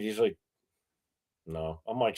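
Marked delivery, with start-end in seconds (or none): 1.5-1.8 very soft, low retold no; sheepish admission. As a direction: imitate a small, reluctant admission. Friend admitting camera was off.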